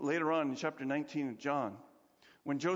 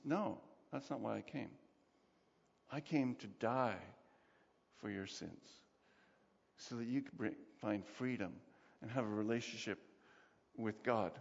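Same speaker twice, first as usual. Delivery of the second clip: Jesus gently reassuring a fearful sinner, soft and low; gentle Jesus; 7 semitones lower, 7 dB quieter than usual. Retold or imitated. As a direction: speak low and tender like comforting a frightened child. Jesus speaking to the frightened.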